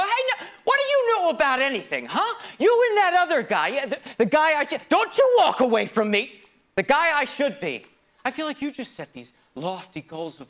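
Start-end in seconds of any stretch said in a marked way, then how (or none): 0.0-7.9 put-on high furious yelling at the kid; angry shout. Adult self yelling at his younger self, furious shout. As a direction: snap back hard, shouting the kid down. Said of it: "So you go".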